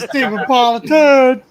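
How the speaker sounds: slow, loud mock title; mock announcement. Laughing friend naming the drawing, loud and slow.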